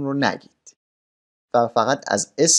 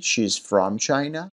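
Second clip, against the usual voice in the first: She's from China, every word stretched; slow demo; much slower than usual. Shown as a demonstration. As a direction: model it slowly for the class. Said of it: "ملاحظه بفرمایید". (آهسته و کشیده برای آموزش)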